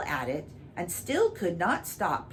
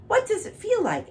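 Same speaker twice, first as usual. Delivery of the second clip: much higher, louder question; curious king; 5 dB louder than usual. Acted. King asking the cow, high and curious. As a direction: ask it high and eager.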